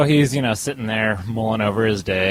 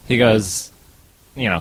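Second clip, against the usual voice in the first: ordinary talk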